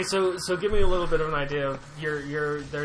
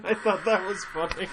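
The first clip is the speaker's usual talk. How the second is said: laughing; 4 semitones higher than usual. Real, not acted. Laughing about joke.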